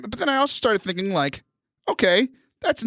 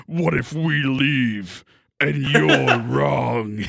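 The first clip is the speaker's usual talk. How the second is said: low, very slow robotic drawl; mock Transformer voice; 5 semitones lower, much slower than usual. Host plays along with co-host's impression, slow deep mock-robot voice. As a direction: drag it out deep, breaking into laughter.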